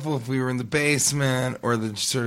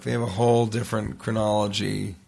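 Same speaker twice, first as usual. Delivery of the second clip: usual speaking voice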